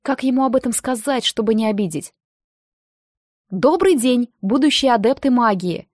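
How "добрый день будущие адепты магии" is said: plain reading voice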